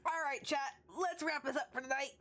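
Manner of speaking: ordinary talk, nothing marked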